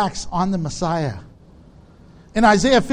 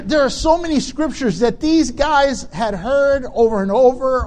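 ordinary delivery

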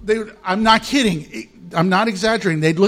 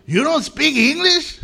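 higher, incredulous mock local voice; 8 semitones higher than usual. A Mexican local asking the pastor why he won't speak Spanish, higher and incredulous. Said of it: "And I'm like".

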